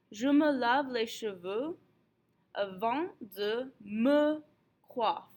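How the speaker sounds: slow model French. Shown as a demonstration. Teacher giving students an example sentence, slow and deliberate. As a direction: say it slowly for learners, word by word.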